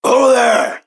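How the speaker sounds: high, loud hail; calling out. Acted. Player greets a town guard, calling out.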